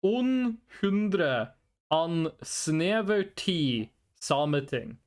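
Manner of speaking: put-on slow Norwegian accent; Norwegian-only answer. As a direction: drag out the Norwegian words slowly. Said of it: "what I said in Norwegian".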